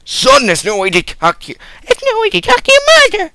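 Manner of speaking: 1 shrill mom voice. Acted. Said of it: "you sound like a girl".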